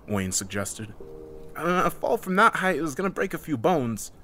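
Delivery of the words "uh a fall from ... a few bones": higher-pitched objection; pitched-up, doubtful counter; pointing out. A narrator's reading. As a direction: point out the flaw, voice raised in pitch. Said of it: "Heather pointed out".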